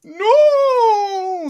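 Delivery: long, high, very loud howl; imitating a desperate man's wail; drawn-out screamed protest; wailed refusal. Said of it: "Sounded just like that".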